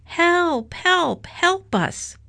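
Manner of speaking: high, repeated calls for help; storybook distant cries, pitch way up; faraway shouting. A narrator's reading. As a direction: call urgently as if from afar. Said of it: "shouted a faraway voice".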